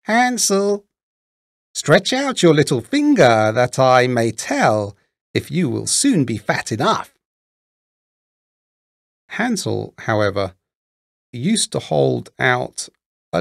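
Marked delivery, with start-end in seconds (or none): 0.0-7.1 put-on high, calling old-woman voice; old witch crying out. A narrator's reading. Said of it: "the old woman visited the little stable and cried".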